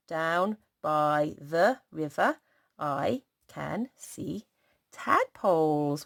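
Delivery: each word of the sentence said slowly; slow reading. Shown as a demonstration. Teacher reading the finished sentence back to children, slowly. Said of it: "reading back the sentence".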